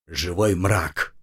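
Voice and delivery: low, slow displeased grumble; grumbling. Acted. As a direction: grumble it out unhappily. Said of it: "недовольно ворчал".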